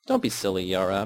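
ordinary delivery, nothing marked

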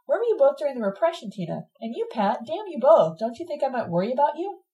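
put-on impatient outburst at old friends. A narrator's reading. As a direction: demand answers, losing patience. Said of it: "I asked her impatiently".

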